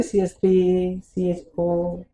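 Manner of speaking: each letter drawn out slowly; slow spelled-out formula. Shown as a demonstration. Teacher slowly spelling the formula for students, drawn out. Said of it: "तो यही तो बोल रहा हूँ मैं".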